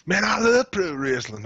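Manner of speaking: slow, loud southern yokel line; mock southern accent. Mocking wrestling fans in a southern accent. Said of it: "I put a southern accent on".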